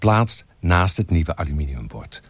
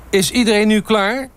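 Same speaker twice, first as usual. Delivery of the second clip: high, louder shouted question; calling out; 5 dB louder than usual. A narrator's reading. Photographer to the assembled staff, calling out. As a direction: call across to check everyone's ready. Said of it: "riep Bosman".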